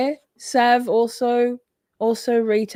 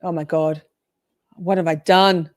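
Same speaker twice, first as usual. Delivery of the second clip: ordinary delivery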